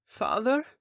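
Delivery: a small daughter crying out for her father; tearful daughter. Acted. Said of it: "Erhi uttered through her tears".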